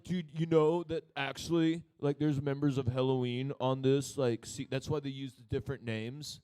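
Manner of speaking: loud dumb-metalhead voice. Acted. Metalhead spreading a band rumour, loud dumb-guy voice. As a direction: push it out like a dim metal fan. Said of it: "one of those dumb metal".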